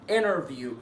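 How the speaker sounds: "انترفيو" as "innerview"; silent T. Shown as a demonstration. Teacher allowing the American form, silent T. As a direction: drop the T out of "انترفيو". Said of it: "ما فيهاش مشكله تنطقها".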